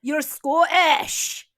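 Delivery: exaggerated Scottish, very slow; mock Scottish accent. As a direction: drag it out in a hammed-up Scottish accent. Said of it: "saying things like".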